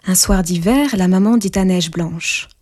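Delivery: ordinary delivery, nothing marked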